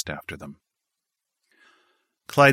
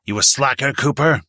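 higher, louder seething challenge; 7 semitones higher, 6 dB louder than usual. A narrator's reading. Sergeant confronting Cooper, seething challenge. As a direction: spit the question at him. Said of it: "The sergeant seethed".

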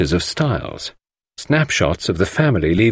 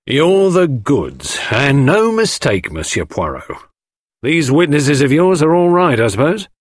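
higher, drawn-out admiration, then a brisk check; Japp, impressed detective; 7 semitones higher, 3 dB louder than usual. Acted. Detective Japp congratulates Poirot on witnesses, impressed. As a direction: praise him heartily.

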